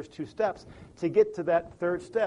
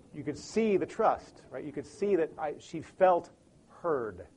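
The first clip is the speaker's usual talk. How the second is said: normal talking voice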